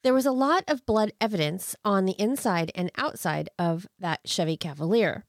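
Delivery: usual speaking voice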